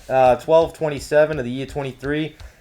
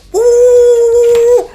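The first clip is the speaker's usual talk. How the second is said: high, loud whoop; hammed-up yelp of joy; game cheer; 10 dB louder than usual. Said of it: "you go like".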